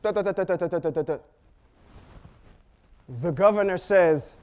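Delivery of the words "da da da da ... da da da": rapid nonsense syllables standing in for Egyptian; playful mock Egyptian gibberish; mock foreign babble. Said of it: "He's speaking Egyptian back to him".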